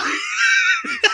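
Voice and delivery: high burst of real laughter; loud laugh. Real, not acted. Friends laughing at the radio guy scene, loud high laugh.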